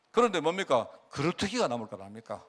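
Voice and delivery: ordinary delivery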